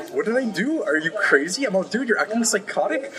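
mock incredulous comeback; retold exasperated protest. Retold quarrel, protesting the accusation.

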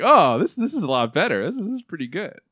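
high-pitched mock relief; pleasantly surprised quote. Viewer worn down by bad segments finding one decent, pleasantly surprised. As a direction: act out being won over, pitch lifted. Voicing a won-over viewer.

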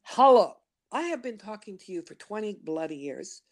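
insistent nudge from inside, mildly hammed up; inner voice. Inner voice scolding her after years ignored, mock insistent. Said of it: "that voice goes".

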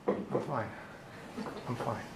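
low, soft and dismissive; a flat, quiet teenage shrug of an answer; mumbled teen brush-off. Retold or imitated. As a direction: mutter it like a sulky teen. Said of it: "You get this".

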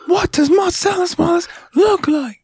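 very high, slow, strained mock shout; angry movie interrogation. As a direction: hammer each word like a furious interrogator. Acted Pulp Fiction interrogation quote.

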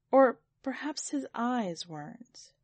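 ordinary delivery, nothing marked

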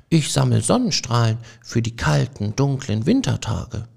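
a hint of a dreamy little mouse; gentle dreamy mouse. A narrator's reading. Frederik explains his work to the mice, lightly voiced and calm.

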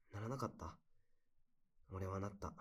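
usual speaking voice

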